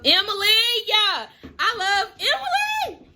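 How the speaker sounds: crying as she says her name; tearful. Real, not acted. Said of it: "I don't know if I'm emotional".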